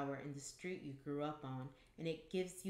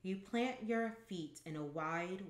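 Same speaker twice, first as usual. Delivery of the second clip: ordinary delivery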